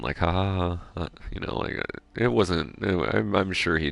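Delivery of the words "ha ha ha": forced ha-ha-ha; fake laugh. Shown as a demonstration. Showing how he humoured the coworker, fake laugh. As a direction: spell out a polite, unconvincing laugh. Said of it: "but that first one".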